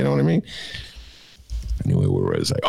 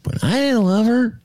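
whiny lefty voice; 14 semitones higher than usual. Pretend. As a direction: play a wounded lefty, pleading. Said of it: "What was, voice was that?".

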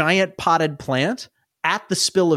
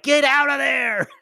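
character yell; 8 semitones higher than usual. Acted. Booger Smith yelling at players.

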